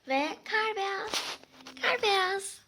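high, slow cooing voice. Real, not acted. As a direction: coo sweetly at a pet. Cooing at her pet.

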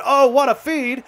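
sudden high, loud cry of delight; excited outburst. Real, not acted. Gamer cheering a perfect pass, excited and loud. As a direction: jump up on the oh, thrilled by the play.

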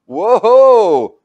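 high, loud mock amazement; big exaggerated whoa. Teacher to class, amazed at four negations, loud and high.

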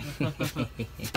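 low, quiet chuckle. Real, not acted. Man letting someone else build the barbecue, low chuckle. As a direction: chuckle softly to yourself.

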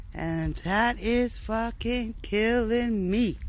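very slow, each word leaned on; slow emphatic. Real, not acted. Sick man telling viewers the illness is killing him, slow and heavy. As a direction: drag it out in exasperation. Frustrated about sibo illness.

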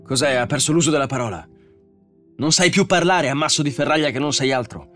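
high, loud, scornful rant; furious outburst. A narrator's reading. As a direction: berate the robot angrily. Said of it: "sbottò Bogart".

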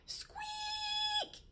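very high squeak shouted out; a huge lion letting out a tiny squeak; lion's squeak. Acted. The lion opens its jaws and squeaks, very high. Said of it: "laisse échapper un".

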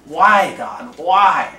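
mild mock wail; retold scream. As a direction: echo her anguished cry to God. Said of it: "just screaming".

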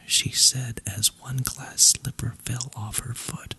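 ordinary delivery, nothing marked

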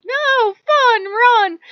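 very high, loud mock shout; gleeful refusal. Player refusing to slow down, gleeful mock shout. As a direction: shout it high, each word punched.

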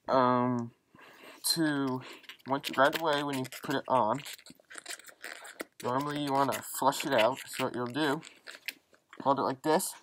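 muffled behind mask; through a gas mask. Real, not acted. Seen 3: full gas mask worn throughout labelled words; references show bare face.

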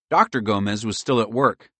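usual speaking voice

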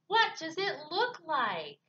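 irritated, drawn-out retort; snappy. Acted. Grumpy Bird retorting to Raccoon, snappy. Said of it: "snapped Bird".

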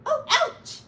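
high, loud yelp of pain; sharp high cry of pain; pained ouch. Acted. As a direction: squeal it like something pricked you. Voice in pain again.

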